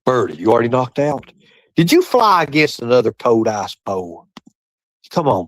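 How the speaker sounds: ordinary delivery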